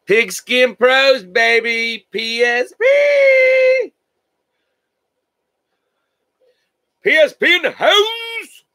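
high, slow, drawn-out hype call; hype shout. Real, not acted. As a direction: stretch it out like a stadium announcer. Shouting out his group name.

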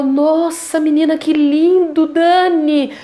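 slow, lightly voiced awed mother; a hint of a delighted mother; marveling mother. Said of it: "Minha mãe ficou maravilhada".

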